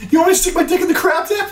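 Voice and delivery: laughing hammed-up leer; mock creep. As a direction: toss it off as a leering joke. Joke voicing the customer.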